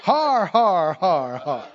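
drawn-out "Har, har" laugh; mock pirate-style laugh; defiant "Har, har". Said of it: "he would say".

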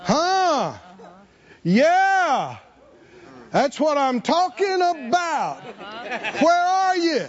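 high, drawn-out exclamations with pauses between; acted astonished delight. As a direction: call out in hammed-up amazement. Acts Jesus exclaiming at the touch.

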